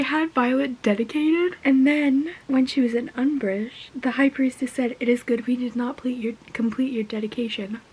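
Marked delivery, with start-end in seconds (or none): 2.5-7.8 soft hushed whisper; conspiratorial whisper. Real, not acted. Fan whispering her theory to viewers, conspiratorial whisper. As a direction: share the theory like a secret.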